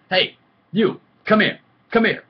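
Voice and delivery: high, very loud shout across a distance; retold commanding call. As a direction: holler to summon someone from far off. Calling a lurking kid over in story.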